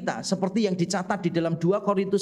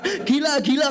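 put-on startled girl; 8 semitones higher than usual.

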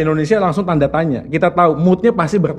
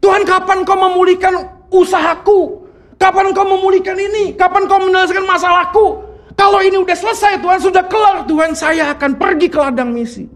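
demanding prayer; 10 semitones higher than usual. Retold or imitated. A believer making demands in prayer, mock shouting. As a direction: bargain with God at the top of your voice. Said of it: "kita berkata gini loh".

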